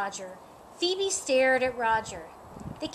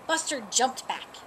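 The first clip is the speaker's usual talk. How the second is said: plain reading voice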